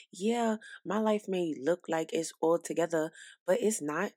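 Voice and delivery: usual speaking voice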